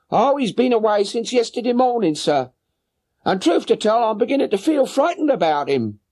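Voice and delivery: high voiced woman, a little anxious; worried boatman's wife. Boatman's wife telling Holmes her husband is gone, high anxious voice. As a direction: answer the gentleman with a high, fretful woman's voice.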